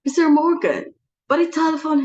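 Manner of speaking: high, loud shocked echo of the name; alarmed outcry at the guest's name; shocked surprise. Acted. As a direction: jolt up on Mister, loud and sharp.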